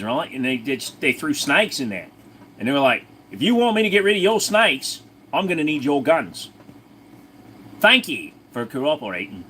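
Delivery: broad put-on Aussie storyteller; lively mock Australian accent. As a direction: tell it as a comic Australian bloke. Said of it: "I'm sorry, I'm Australian".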